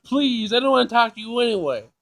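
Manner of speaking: normal talking voice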